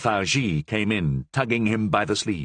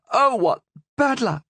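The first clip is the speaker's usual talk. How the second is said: high, louder mock dismay; dismayed cry; 4 dB louder than usual. Queen lamenting no one knows Dutch, high dismayed cry. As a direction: cry out in comic disappointment. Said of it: "cried the Queen".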